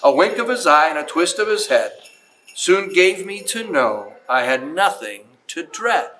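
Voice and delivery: put-on reading a Christmas poem aloud to children, lilting; storyteller voice, unhurried and sing-song; story narration. Museum host reads to the kids in a storyteller voice.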